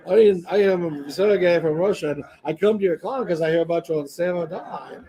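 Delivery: put-on a weak try at a Russian accent. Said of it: "I can't do a Russian accent".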